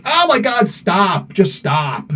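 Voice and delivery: high mock-exasperated plea; mock annoyed at the vaping. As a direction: beg them to stop, half-joking. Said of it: "it's like".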